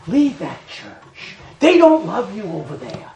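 hushed, tempting whisper in the ear; sly whispered temptation; devil's whisper. Acted. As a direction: whisper like the devil luring her away. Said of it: "the devil whispers in their ear".

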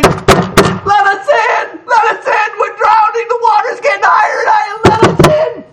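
drowning crowd screaming at the sealed ark door; desperate pleading cries. Acted. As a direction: beg like the water is at your chin.

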